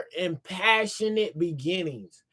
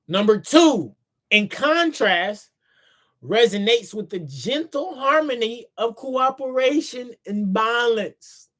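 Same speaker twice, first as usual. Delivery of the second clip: reading aloud; 4 semitones higher than usual. Acted. Reading own book aloud.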